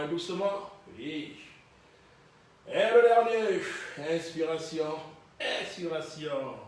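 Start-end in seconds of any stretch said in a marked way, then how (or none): 2.7-3.6 last rep call. Real, not acted. Coach announces last repetition.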